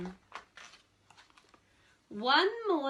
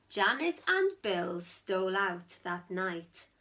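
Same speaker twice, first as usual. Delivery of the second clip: ordinary delivery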